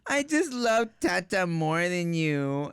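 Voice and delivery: dad mimicking his four-year-old's hurtful line; imitating daughter. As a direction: say it like a blunt pouty toddler. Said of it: "this is what was so mean".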